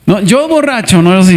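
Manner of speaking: ordinary talk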